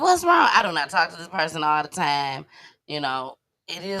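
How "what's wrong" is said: alarmed. Retold or imitated. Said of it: "And I'm like".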